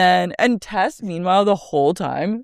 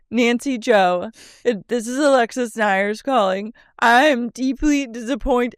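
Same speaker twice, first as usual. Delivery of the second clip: mock formal voicemail; 7 semitones higher than usual. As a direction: leave it like an offended diva. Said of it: "And she goes".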